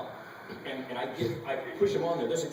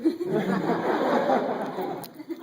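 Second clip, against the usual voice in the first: high delighted laugh; 9 semitones higher, 5 dB louder than usual. Real, not acted. Laughing at own line.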